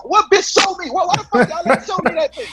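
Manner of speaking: high, laughing outrage; outraged disbelief with a laugh. Retold or imitated. Player confronting doctors over hidden X-rays, high and laughing.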